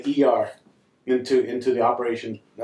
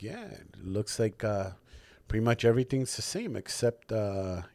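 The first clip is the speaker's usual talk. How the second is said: low, soft, unhurried doctor; quietly matter-of-fact, pitched down; deadpan doctor imitation; 6 semitones lower, 10 dB quieter than usual. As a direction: imitate a doctor slipping in a dry insult. Doctor delivers an insult, deadpan.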